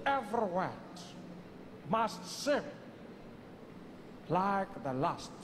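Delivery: long pauses between phrases; weighty and deliberate. Acted. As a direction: let each phrase land before the next. Mandela models weighty delivery, long pauses.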